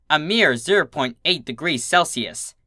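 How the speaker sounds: usual speaking voice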